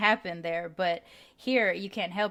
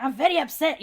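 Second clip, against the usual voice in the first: grandma upset; 7 semitones higher, 5 dB louder than usual. Retold or imitated. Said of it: "my grandma's like".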